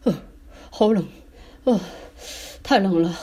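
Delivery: gasped, broken-up words; shivering with cold. Acted. Dad just out of the icy water, shivering. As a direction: shudder between short bursts. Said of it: "他打着哆嗦".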